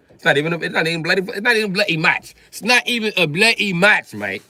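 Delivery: high, loud mock British accent. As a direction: protest in a hammed-up British accent. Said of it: "when they come from Britain".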